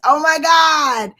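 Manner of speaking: loud, high, drawn-out "oh my God"; excited exclamation. Real, not acted. Host reacting to a follower's baby news, amazed cry. Reacts to Khadija's pregnancy comment.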